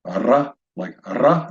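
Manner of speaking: Ra with a rolled, Spanish-style R; trilled R. Shown as a demonstration. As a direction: trill the R at the start of ra. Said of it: "Some people will trill their R's".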